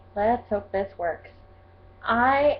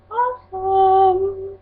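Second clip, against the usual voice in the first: gushing; 11 semitones higher, 3 dB louder, much slower than usual. Real, not acted. Gushing about favourite book.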